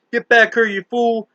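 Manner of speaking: sharp, angry order to a fool who walks off; snapped. Acted. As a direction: bark it at him like a furious boss. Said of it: "Missus Sigsbee snapped".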